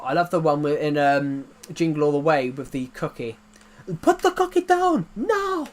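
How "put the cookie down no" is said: very high, loud action-hero yell; hammed-up Austrian movie-star outburst; Arnold Schwarzenegger. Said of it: "When I try and do a Terminator voice".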